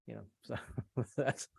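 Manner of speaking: ordinary talk, nothing marked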